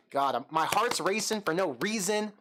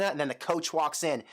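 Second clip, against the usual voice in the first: ordinary talk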